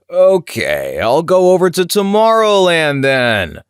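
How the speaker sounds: loud, drawn-out knowing exaggeration; imitated annoyed guest playing along, slow and loud; winking and annoyed. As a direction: stretch it out like a huge conspiratorial wink. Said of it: "winking at me while equally getting annoyed".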